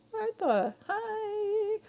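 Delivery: joyful squeal of Martha; excited greeting. Real, not acted. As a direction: greet a friend you're thrilled to see. Delighted greeting to viewer.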